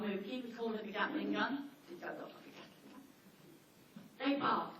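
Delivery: ordinary talk, nothing marked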